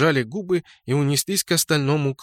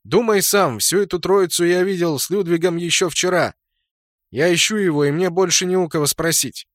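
high, raised voice calling across; shouted appeal; 6 semitones higher, 3 dB louder than usual. A narrator's reading. Hero shouts his case to the clan leader, shouting. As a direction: argue your case loudly, pitch up. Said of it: "крикнул я".

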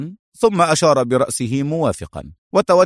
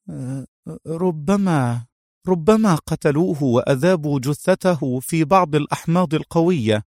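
higher-pitched, halting start, then a tentative theory; hesitate, then venture the guess; hesitant; 4 semitones higher than usual. A narrator's reading. Mona guessing at the doctor's fate, hesitantly. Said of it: "قالت منى بتردد".

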